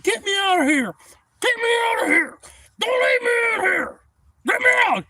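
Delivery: high frantic yell; panicked shout. Retold or imitated. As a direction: scream to be pulled out. Stuck man pleading.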